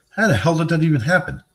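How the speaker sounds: incredulous. Real, not acted. Viewer's forklift story.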